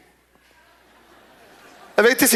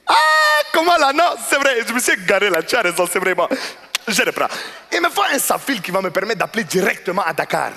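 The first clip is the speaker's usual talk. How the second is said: flustered customer catching himself; 2 semitones higher than usual. Retold or imitated. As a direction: caught out, recovering and pressing on. Customer backtracks, then demands.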